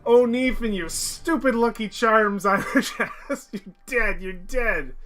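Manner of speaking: high, loud taunt with laughter; gloating triumph. Real, not acted. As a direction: taunt a beaten enemy, laughing. Gloating over beaten game enemy.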